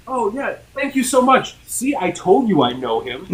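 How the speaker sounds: high-pitched imitation of a thrilled friend; excited gratitude. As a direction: gush thanks, then show off proudly. Retold excited friend on FaceTime.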